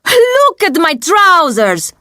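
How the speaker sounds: high-pitched outraged complaint; angry shout. A narrator's reading. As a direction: protest indignantly over ruined clothes. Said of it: "Fabio shouts at me".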